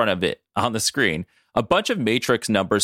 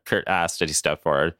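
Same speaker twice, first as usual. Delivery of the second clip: usual speaking voice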